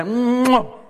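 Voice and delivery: loud, high mock kiss sound; exaggerated lip-smack kiss. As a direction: plant a big hammed-up smooch. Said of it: "imagine she responds".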